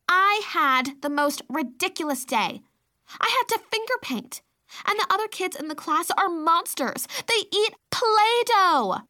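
put-on a fed-up little girl complaining; indignant toddler. Toddler complaining about her day.

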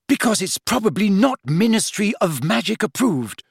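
high, prim rebuke; prim lecture. Acted. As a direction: lecture them primly. Hermione lecturing.

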